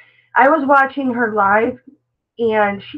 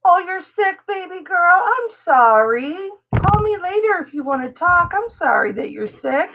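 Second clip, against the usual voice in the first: sympathetic friend; 7 semitones higher than usual. Retold or imitated. Voicing sympathy to sick friend.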